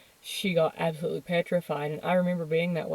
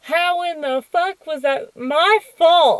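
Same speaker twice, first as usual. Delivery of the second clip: higher, louder, slowed disbelief; incredulous outrage; 9 semitones higher, 8 dB louder, slower than usual. Real, not acted. About the teacher blaming her, loud incredulous outrage. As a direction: fume at how absurd it was. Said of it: "outrage at the teacher's logic".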